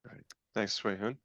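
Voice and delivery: normal talking voice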